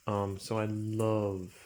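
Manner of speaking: ordinary talk, nothing marked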